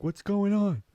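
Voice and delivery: lightly voiced confused dog; dog's confused voice. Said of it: "he looks at me like".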